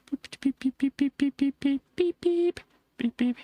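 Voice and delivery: high, rapid beep beep honks; playful horn noises on the roundabout; mock car horn. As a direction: honk like a toy car.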